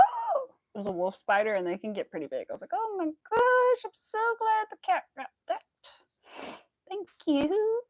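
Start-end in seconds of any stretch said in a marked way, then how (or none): 0.0-0.6 very high yelp of shock; retold startled gasp. Recalling finding the spider in the cat's box, startled yelp. 2.7-7.8 high, loud, slow gush of relief; relieved gushing. Retold or imitated. Thanking the cat for catching the spider, high and relieved.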